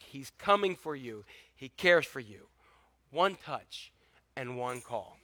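normal talking voice

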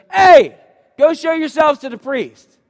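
high, loud call across a distance; Jesus shouting back to the lepers. Retold or imitated. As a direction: shout the instruction back to them. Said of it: "Jesus is screaming back to them".

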